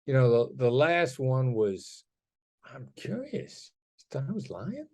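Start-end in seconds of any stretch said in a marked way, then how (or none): 2.6-4.9 quiet soft-spoken question; gentle curious. Shown as a demonstration. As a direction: ease it in softly, curious not accusing. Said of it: "that lands really gently".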